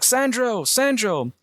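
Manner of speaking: high-pitched imitated call, no real volume; mock yell. Angelo yelling to Sandro at the fight, imitated high mock yell. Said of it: "Angelo yells out, he's like".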